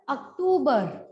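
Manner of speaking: ordinary talk, nothing marked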